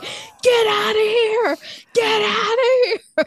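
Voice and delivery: high, loud, drawn-out mock holler; imitated panicked yell. Counselor driving off a supposed bear, acted-out yelling. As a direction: bellow it slowly like scaring off a bear.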